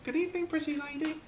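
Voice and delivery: high squawky parrot voice; high-pitched parrot impersonation. Acted. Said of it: "the parrot turns around and says".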